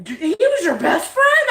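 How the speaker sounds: high, loud, laughing question; mock disbelief. Husband astonished at the rooster's betrayal, imitated high and laughing. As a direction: squeal it in exaggerated surprise.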